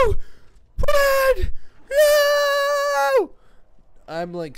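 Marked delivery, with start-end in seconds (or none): 0.0-3.3 very high, long screams after a gasp; screaming. Retold or imitated. Re-enacting panic as teammate lags out, screaming. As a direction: shriek in mock despair, holding the vowels. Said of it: "screaming my tits off so hard over here".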